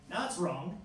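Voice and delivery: ordinary talk, nothing marked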